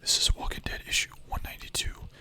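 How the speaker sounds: ordinary talk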